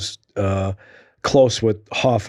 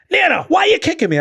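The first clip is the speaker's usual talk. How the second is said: mimicking Mickey. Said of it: "and he goes".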